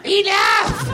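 falsetto yell at a police officer; hammed-up squeaky outburst; mock high-pitched shout. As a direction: screech it way up high.

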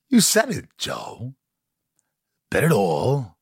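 odd jumpy Walken cadence, pitch leaping then dropping; Christopher Walken impression. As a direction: lightly mimic a quirky actor's halting rhythm. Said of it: "And he goes".

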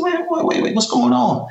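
high, alarmed wait wait wait; startled. Retold or imitated. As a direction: caught off guard, pitch jumps up high. Friend suddenly yells when leaving.

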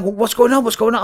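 high-pitched, panicky questioning; retold flustered panic; doctors freaking out. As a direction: imitate alarmed doctors. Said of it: "they're freaking out".

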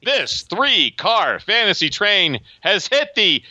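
high, slow, drawn-out conductor call; train announcer. Acted. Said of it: "for the keeping it up segment".

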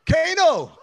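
high, excited cry of the name. Retold or imitated. Reading an old note on seeing Kano, excited. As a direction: burst out with a fan's thrill. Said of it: "I was so excited".

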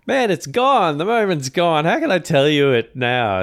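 high, loud mock despair; mock exasperation. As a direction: lament the lost moment. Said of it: "I said".

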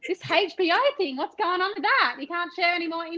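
high-pitched mock hosting; stand-in host. Guest pretending to take over hosting from Jeremiah, mock host. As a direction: play at taking charge of the show, perky and probing. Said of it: "take o-, over the hosting right now".

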